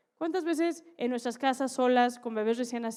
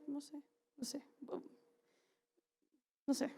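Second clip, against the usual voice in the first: whisper; 4 semitones higher, 9 dB quieter than usual. Retold or imitated. Sneaking past the director's refusal.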